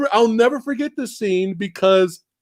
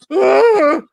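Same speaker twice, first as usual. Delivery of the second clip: mock scream; 11 semitones higher, 5 dB louder than usual. Character running away scream.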